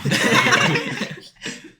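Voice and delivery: high unvoiced laugh. Real, not acted. Laughing at Alba.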